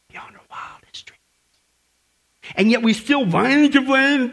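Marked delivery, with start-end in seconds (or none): 0.1-1.2 hushed awed whisper; barely voiced awe. Real, not acted. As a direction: breathe it out in wonder. Awed description of heaven.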